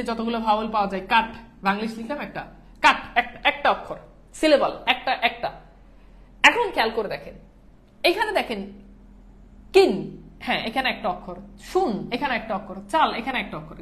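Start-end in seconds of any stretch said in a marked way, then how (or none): none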